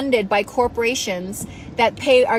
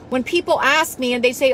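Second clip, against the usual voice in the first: usual speaking voice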